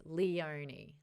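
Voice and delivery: "Leonie" said as lee-OH-nee; her preferred pronunciation. Shown as a demonstration. Host gives her own version, "Leonie" as lee-OH-nee. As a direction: begin "Leonie" with Lee, full OH after it. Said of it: "I would say Lee as in".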